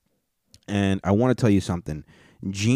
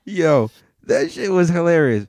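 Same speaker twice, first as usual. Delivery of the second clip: excited; 10 semitones higher, 7 dB louder, slower than usual. Real, not acted. Reacting to funny clip.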